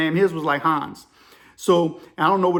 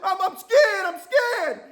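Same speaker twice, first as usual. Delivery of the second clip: high-pitched mock terrified cry; hammed-up squeal of fear; panicked; 13 semitones higher, slower than usual. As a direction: imitate the caller panicking. Caller panics at drawn guns.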